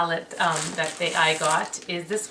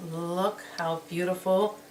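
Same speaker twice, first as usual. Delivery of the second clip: usual speaking voice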